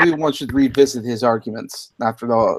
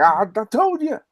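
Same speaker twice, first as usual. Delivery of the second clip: attempted Southern accent; 9 semitones higher than usual. Mocking Johnny Fox, weak attempt at a Southern accent. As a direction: try a Southern twang and half fail. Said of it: "I can't do the Southern accent".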